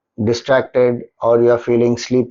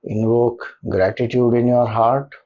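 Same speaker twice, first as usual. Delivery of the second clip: soft, lower meditation voice with long pauses; guided meditation; 4 semitones lower, 6 dB quieter than usual. Acted. Leader settling listeners into a comfortable posture and gratitude, guided meditation. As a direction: guide them gently into stillness, leaving long silences between phrases.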